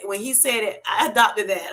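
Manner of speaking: normal talking voice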